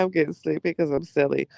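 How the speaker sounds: normal talking voice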